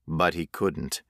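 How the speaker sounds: ordinary delivery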